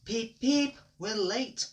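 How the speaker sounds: high, loud whistle-like peeps; fussing engine. A narrator's reading. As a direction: fret like a late little engine. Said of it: "fussed Edward".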